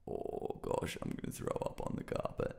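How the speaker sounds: queasy, unvoiced low murmur; feeling sick. Retelling standing nauseous in Abby's space, queasy hushed voice. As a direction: groan it softly, as if about to vomit. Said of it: "started feeling super sick".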